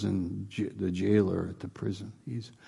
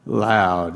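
Loud said short and louder; stress on loud; 2 semitones lower, 7 dB louder than usual. Real, not acted. Preacher answering his own question, Loud punched. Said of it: "What kind of voice?".

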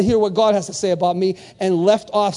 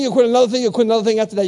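ordinary delivery

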